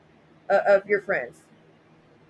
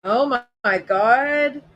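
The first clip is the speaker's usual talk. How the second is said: mock shock; 4 dB louder, much slower than usual.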